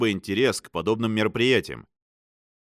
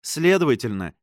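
usual speaking voice

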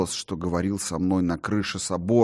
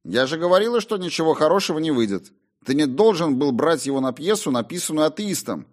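scolding mother; 9 semitones higher, 3 dB louder, faster than usual. Acted.

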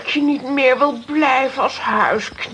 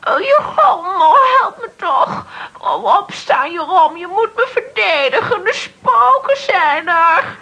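sobbing panic, calling for help; frantic tearful pleading for protection; terrified crying plea; 2 semitones higher than usual. Acted. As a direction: cry out to Jeroen in tearful terror. Baroness attacked by ghosts, pleading.

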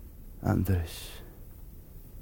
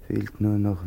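normal talking voice

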